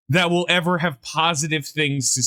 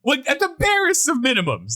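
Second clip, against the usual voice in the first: mock complaining; 7 semitones higher than usual. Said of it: "They'd be like".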